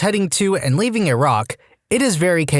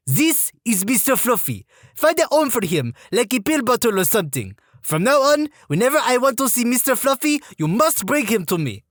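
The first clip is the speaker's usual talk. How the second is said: high French-Canadian drill sergeant; French-Canadian accent; 8 semitones higher than usual. Retold or imitated. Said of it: "female French-Canadian sergeant with this accent".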